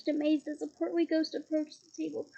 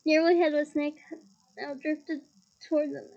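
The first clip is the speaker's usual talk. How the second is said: plain reading voice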